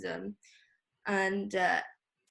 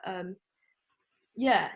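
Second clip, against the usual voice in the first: usual speaking voice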